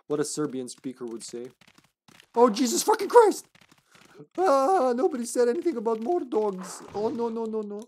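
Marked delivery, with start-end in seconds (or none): 2.3-7.8 high panicked yell, swearing. Real, not acted. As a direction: scream it as dogs rush you. Dogs attack suddenly.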